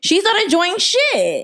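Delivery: high, slow exasperated drag on shit; outraged friend defending the daughter; indignant. Real, not acted. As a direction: draw it out in disbelief.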